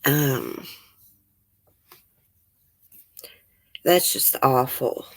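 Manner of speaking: quietly choked up, holding back tears; a real, softly shaken reaction; real upset. Real, not acted. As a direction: let the upset catch in the voice. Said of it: "I'm, I'm getting upset".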